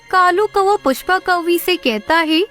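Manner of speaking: plain reading voice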